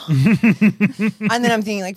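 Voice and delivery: ordinary delivery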